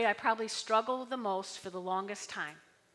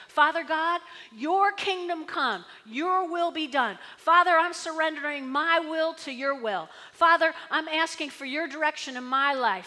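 sincere prayer; 4 semitones higher, 6 dB louder than usual. Shown as a demonstration. Pastor modelling a surrendering prayer to God, sincere. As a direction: pray it with heartfelt surrender.

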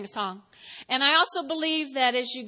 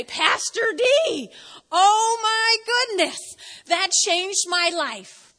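high, loud, slowed amazed cry; gushing excitement; 5 semitones higher, 4 dB louder, slower than usual. Retold or imitated. Congregant rushing up to Pastor D, gushing amazed thanks. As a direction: gush like life was changed.

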